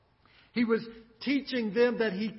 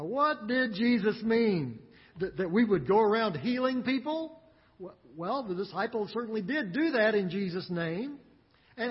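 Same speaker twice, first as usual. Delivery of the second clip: normal talking voice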